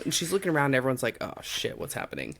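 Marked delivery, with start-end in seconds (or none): 1.2-2.4 whispered, dropping low and soft; hushed panic. Retold or imitated. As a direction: murmur it in alarm. Onlookers voiced in panic.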